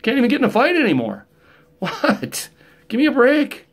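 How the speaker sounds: high, fast exasperated complaint; mock whine. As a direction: whine it like a cheated kid. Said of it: "I'm like".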